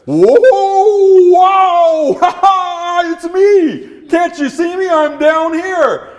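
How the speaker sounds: very high, loud, howling dog voice; high cartoon dog calling for attention; excited dog. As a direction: holler up like an eager dog. Dog announces itself to the leader.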